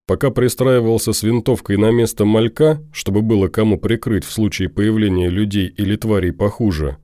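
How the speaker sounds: usual speaking voice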